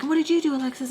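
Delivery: high-pitched accusing question; mad. Retold or imitated. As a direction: imitate an angry woman demanding answers. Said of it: "she was so mad".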